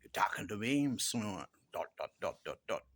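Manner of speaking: lightly put-on New York accent; a hint of New York movie tough guy. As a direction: toss it off in mock New York tough-talk. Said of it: "deliver it in a New York accent".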